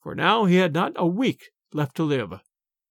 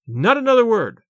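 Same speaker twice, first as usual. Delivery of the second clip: louder, higher royal cry cutting him off; cried out; 5 semitones higher, 5 dB louder than usual. A narrator's reading. King cutting off the abbot, crying out louder. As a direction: silence him with a sharp command. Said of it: "cried the king".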